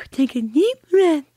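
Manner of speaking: imitating her daughter. Daughter repeating her mother during port access, high child voice. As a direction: parrot it back in the girl's high voice.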